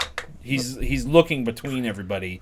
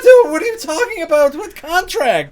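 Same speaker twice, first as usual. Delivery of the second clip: confused, much higher and louder; flustered disbelief; bewildered; 10 dB louder than usual. Acted. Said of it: "he's like".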